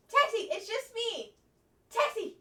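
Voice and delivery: high, loud call to the dog; excited calling. Pretend.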